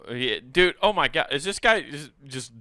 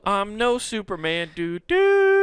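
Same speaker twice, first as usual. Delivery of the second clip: mock surgeon; 7 semitones higher, 4 dB louder, slower than usual. Surgeon voiced in mock quote.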